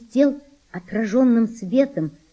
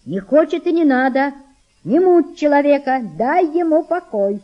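higher, louder, impatient scolding; scolding mother; 6 semitones higher, 5 dB louder than usual. A narrator's reading. Mother orders girl to leave boy alone, scolding. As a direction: tell the child off firmly, voice raised.

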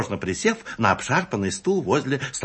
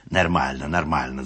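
nodding manager; 7 semitones lower than usual. A narrator's reading. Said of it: "закивал тот".

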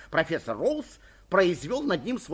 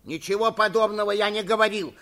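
loud, higher indignant denial; raised-voice exclamation of denial; exclaiming; 5 semitones higher, 7 dB louder than usual. A narrator's reading. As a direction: burst out in protest. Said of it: "воскликнул Суханок".

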